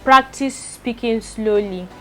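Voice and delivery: slowly and carefully spoken, word by word; slow practice read. Shown as a demonstration. A vlogger showing how to practise speaking slowly. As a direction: ease each word out slowly.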